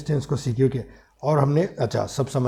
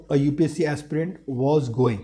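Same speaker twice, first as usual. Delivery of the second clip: slow dictation; much slower than usual. Shown as a demonstration. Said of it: "dictating the sentence slowly".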